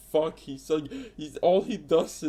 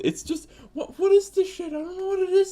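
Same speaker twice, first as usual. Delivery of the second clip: much higher, strained and incredulous; exasperated disbelief; 3 dB louder, faster than usual. Real, not acted. Fan rants about the couple she hates, high exasperated squeal. Ranting about hated relationship.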